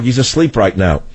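ordinary talk, nothing marked

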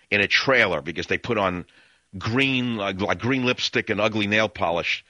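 ordinary talk, nothing marked

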